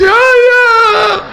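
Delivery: high wailing call of ayah; weeping daughter calls her father, high and wailing; crying daughter. Retold or imitated. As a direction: cry out like a heartbroken daughter.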